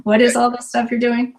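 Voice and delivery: playful impression of her kids asking what mom does; teasing kids. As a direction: imitate puzzled grown kids with a laugh. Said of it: "sometimes they're like".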